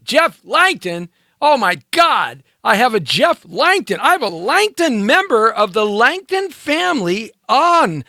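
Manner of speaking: high, drawn-out astonished announcing; excited amazement. Real, not acted. Host amazed a Langton family member joined, excited amazement.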